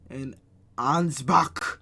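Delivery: punchy, loud German place name; mock angry German. Reading German town names to a friend, mock-angry German. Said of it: "You gotta say it angry 'cause it's in German".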